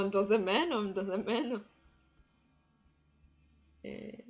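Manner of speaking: ordinary talk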